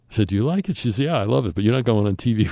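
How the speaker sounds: usual speaking voice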